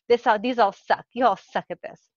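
ordinary delivery

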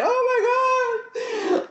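high slow sobbing cry; crying wail. Retold or imitated. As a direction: wail it out through tears. Retelling crying last week.

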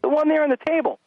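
exasperated dad. Retold or imitated. Father to son who won't look, mock exasperated. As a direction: spell it out with strained patience.

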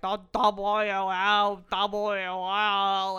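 loud, slow, slurred zombie-like moan; incoherent rambling. Retold or imitated. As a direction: imitate a sick mother babbling her words. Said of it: "She's just rambling incoherently".

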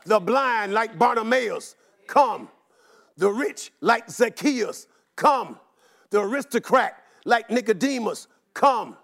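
ordinary talk, nothing marked

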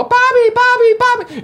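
put-on high, squealing kids calling out; mimicking children calling for the butcher; excited kids. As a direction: kids running up, high and loud. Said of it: "kids ... go".

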